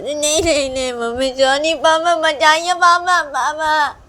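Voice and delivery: put-on high, loud panicked child crying for papa; terrified child pleading. Child being dragged off screaming for her papa.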